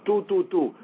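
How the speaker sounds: short clipped "two, two, two" like staccato shofar notes; staccato blasts. Shown as a demonstration. Teacher demonstrating short staccato shofar blasts. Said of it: "The yebaba is a staccato sound".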